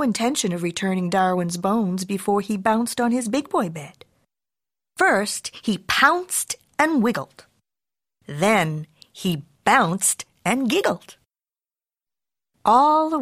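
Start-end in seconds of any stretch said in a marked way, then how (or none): none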